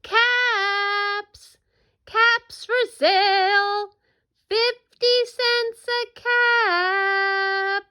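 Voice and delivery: high, long-held vendor's cry; street seller's call. Acted. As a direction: call out your wares slowly, sustaining each word.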